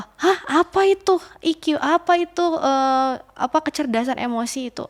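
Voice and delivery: high-pitched mock puzzlement; menirukan orang bingung. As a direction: play someone clueless, pitch jumping up then easing. Menirukan orang awam yang bingung. (pura-pura bingung, nada tinggi)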